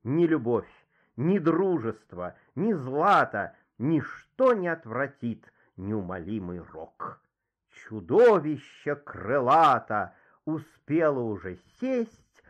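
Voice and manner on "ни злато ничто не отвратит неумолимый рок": ordinary delivery, nothing marked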